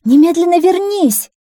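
high, loud motherly order; alarmed, high-pitched shout; shouted command. A narrator's reading. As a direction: call the child back urgently. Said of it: "крикнула она".

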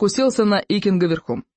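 usual speaking voice

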